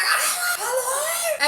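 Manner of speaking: high, loud yell; exasperated high shout, "Hello?" falling; shouted reply. Retold or imitated. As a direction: yell it over the blaring audio. Retold shouting over podcast.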